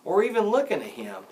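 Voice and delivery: ordinary delivery, nothing marked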